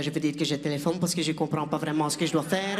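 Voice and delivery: French in a low, mock Flemish accent. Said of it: "avec un accent flamand".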